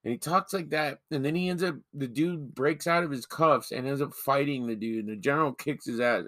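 ordinary talk, nothing marked

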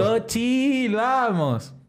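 high, drawn-out mock call. Bystander stepping into a fight, high drawn-out call. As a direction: stretch it out high to break things up.

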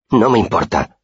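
heated. A narrator's reading. Simón protests his friend's punishment, mildly heated. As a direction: protest with a touch of heat. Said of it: "dijo Simón muy acalorado".

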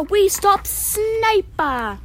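high, drawn-out victory cheer. Acted. Nora celebrating stopping Swiper, cheering. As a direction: stretch out the triumph in a high voice. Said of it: "cheers Nora".

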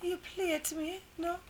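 soft, tentative British attempt; mock British accent. Retrying the line for doubting co-hosts, shaky-credibility British accent. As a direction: try out a fake British accent quietly. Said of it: "British accent".

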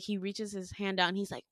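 ordinary talk

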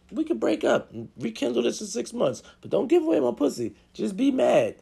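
high-pitched squeaky bargaining. Acted. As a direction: bargain with a partner in a squeaky put-on voice. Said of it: "I have to throw on my squeaky voice".

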